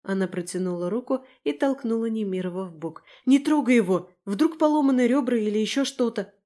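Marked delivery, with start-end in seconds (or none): 3.3-6.3 alarmed, louder and higher protest; heated indignant outburst. A narrator's reading. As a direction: object sharply, voice raised. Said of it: "возмутилась я".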